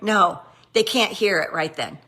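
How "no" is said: ordinary talk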